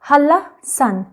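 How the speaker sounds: slow "हल्ला सन" with a long l; unhurried model of "हल्ला सन"; assimilated pronunciation. Shown as a demonstration. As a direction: say "हल्ला सन" slowly, doubling the l. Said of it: "इसको हम पढ़ेंगे".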